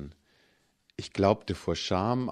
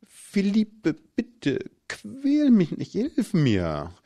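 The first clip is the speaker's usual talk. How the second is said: much higher, teasing fake begging; mock pleading; 12 semitones higher, 3 dB louder, much slower than usual. A narrator's reading. Man playing helpless for his boyfriend's fantasy, mock pleading. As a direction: play the helpless victim, hammed-up.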